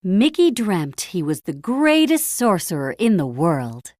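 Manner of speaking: plain reading voice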